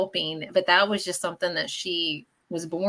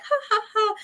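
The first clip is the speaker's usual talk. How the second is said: much higher playful laugh; high mock laugh; 14 semitones higher than usual. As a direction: laugh at the old joke, high.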